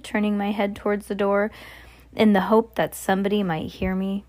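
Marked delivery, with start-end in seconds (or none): none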